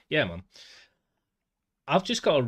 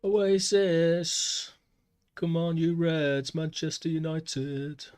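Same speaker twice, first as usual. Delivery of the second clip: Manchester accent chanted higher and louder, slow; Manc accent; 7 semitones higher, 5 dB louder, much slower than usual. Shown as a demonstration. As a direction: belt it like a Manchester football fan. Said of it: "I am from Manchester in England".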